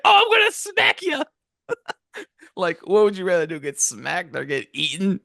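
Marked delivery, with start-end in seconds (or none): 0.0-1.2 much higher, squeaky sing-song threat; hammed-up squeaky smack threat; mock shrill threat. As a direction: threaten sweetly but shrill. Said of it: "she was like".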